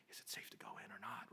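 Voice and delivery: hushed, unsure whisper asking if it's safe; nervous staff member whispering through the door; whispered worry. Retold or imitated. Staff waiting outside during intubation.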